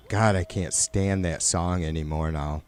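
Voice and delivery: normal talking voice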